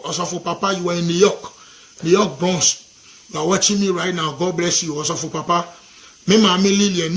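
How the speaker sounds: ordinary delivery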